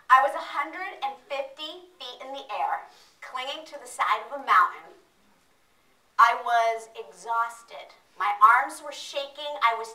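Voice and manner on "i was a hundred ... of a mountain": usual speaking voice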